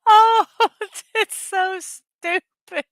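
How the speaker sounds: high, slow, laughing through the words; real giggly, high-pitched speech; helpless laughter. Real, not acted. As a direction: barely get it out for laughing. Cracking up at silly memes.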